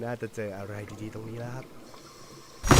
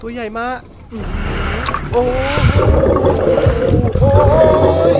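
slow, high, loud amazement; drawn-out wow; awed exclamation; 10 semitones higher, 16 dB louder, much slower than usual. Real, not acted. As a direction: gasp at the huge shark. Huge shark appears.

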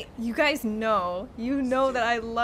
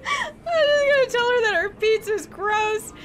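laughing; 10 semitones higher, 3 dB louder than usual. Real, not acted. Laughing at a rude character.

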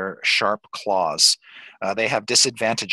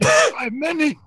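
very high, slow eerie character voice; otherworldly high voice, words stretched; Barmy; 3 dB louder than usual. Acted. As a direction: intone it high and drawn out. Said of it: "Barmy says".

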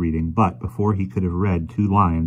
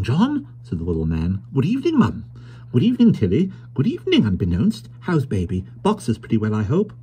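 little man's voice; 4 semitones higher than usual. Acted. Little toymaker greeting the household.

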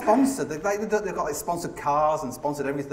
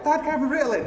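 high-pitched imitation of his son; pleading child; 10 semitones higher, faster than usual. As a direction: pipe up like a kid asking Dad. Imagines his son asking him.

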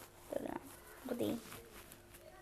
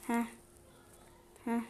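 usual speaking voice

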